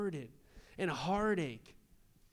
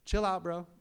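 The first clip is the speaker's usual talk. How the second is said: laid-back Jesus. Retold or imitated. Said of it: "And Jesus is like".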